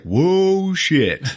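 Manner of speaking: loud, high drawn-out astonishment; shocked reaction. Retold or imitated. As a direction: imitate being suddenly stunned. Shocked reaction as Stark steps out.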